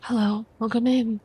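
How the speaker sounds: usual speaking voice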